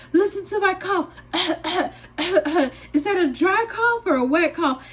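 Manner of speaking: high, urgent, half-laughing plea; listen to my cough, she demands; frantic plea. Retold or imitated. She begs her friend's husband to judge her cough.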